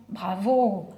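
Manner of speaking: normal talking voice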